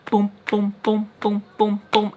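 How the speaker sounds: imitated heartbeat, steady repeated beats; pounding heartbeat. Telling how scared she was, imitated heartbeat. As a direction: thump out the booms evenly. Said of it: "my heart was going boom".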